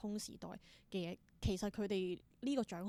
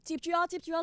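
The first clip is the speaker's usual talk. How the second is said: calling out to catch; 9 semitones higher, 8 dB louder, faster than usual. Pretend. Audience energy thrown at performer.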